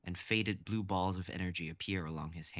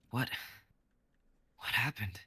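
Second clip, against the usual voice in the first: dazed whisper; 4 semitones higher than usual. Acted. Kai wakes dazed from wreckage.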